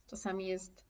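ordinary talk

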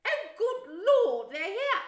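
high, very loud flustered cry; frantic. A narrator's reading. Aunt caught out by the doorbell, frantic. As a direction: panic loudly as guests arrive. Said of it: "said Aunt Petunia frantically".

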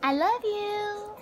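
high, slow and sweet, one word at a time; singsong teaching phrase. Shown as a demonstration. Mother teaching her baby to say it, high and slow. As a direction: coax the baby to copy you. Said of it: "Ngày nào mình cũng học cái câu này hết".

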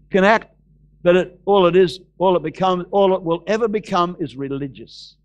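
usual speaking voice

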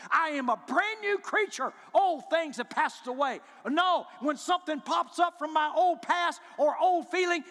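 loud, rising preacher's declaration; fiery sermon shout; preaching at full force. Acted. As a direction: drive it home to the congregation, loud.

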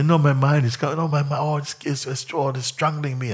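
high, strained imitation of being choked by the mind; mock distress. Teacher parodying a seeker's dramatic complaint, strained and high. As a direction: play someone gasping that the mind strangles them. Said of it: "You say".

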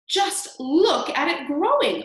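loud, high, stretched-out exclamation; excited cry. A narrator's reading. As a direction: cry out in slow amazement. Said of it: "Aunt Spiker cried".

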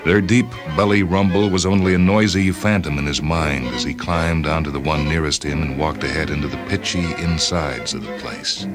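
ordinary delivery

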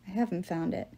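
understated sorrow; sad. A narrator's reading. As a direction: let it drop softly with regret. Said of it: "he said sadly".

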